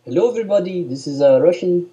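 loud, high, hammed-up Russian accent; bad Russian accent. Showing off to a friend, loud mock Russian accent. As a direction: boom out a botched Russian accent. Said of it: "You sound like a cross between an Arabic man".

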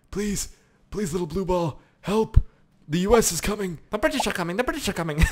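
high, squeaky-small begging; mock panicked pleading. Chased player begging blue ball for help, mock panic. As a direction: plead in a put-on frightened little voice.

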